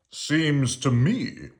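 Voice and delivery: slow, drawn-out fatherly voice; Papa musing slowly over his paper; Papa's voice. A narrator's reading. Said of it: "said Papa".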